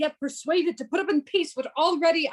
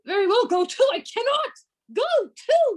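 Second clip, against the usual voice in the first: angry outburst; 8 semitones higher than usual. Acted. Roderigo's angry quarrel with Iago.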